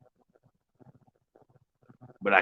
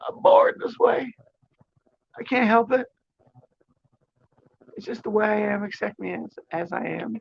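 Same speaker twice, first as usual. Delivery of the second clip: way higher mock-dramatic plea, slowed on the first line; sarcastic high voice; 11 semitones higher than usual. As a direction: ham up a whiny self-defence in falsetto. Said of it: "The sarcasm just came out".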